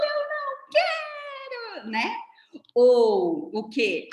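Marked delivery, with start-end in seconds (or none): 0.0-1.9 hammed-up tantrum cry, long and high; mock tantrum scream. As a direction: imitate a child screaming a refusal. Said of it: "olha pra você e grita".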